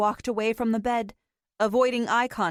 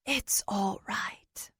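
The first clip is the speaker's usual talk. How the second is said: slow, breathless whisper; 6 dB quieter, much slower than usual. Acted. April comforting the dying old man, whispering. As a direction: soothe him in a hush. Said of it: "she whispered".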